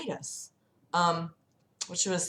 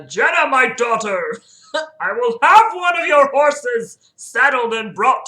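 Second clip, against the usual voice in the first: loud, raised kingly voice; grand king; 6 semitones higher, 10 dB louder than usual. Acted. King orders a horse for his daughter, loud and grand. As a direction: proclaim it like a storybook king. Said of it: "the king speaks in Old English".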